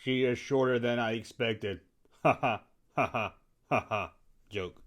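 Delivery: quiet deadpan joke with a fake laugh; softly spoken, stilted ha-ha; [name]. [name] jokes to the host about Phasma, quiet and deadpan. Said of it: "What about you, [name]?".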